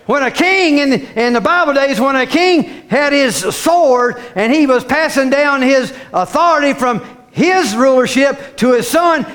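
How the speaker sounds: ordinary delivery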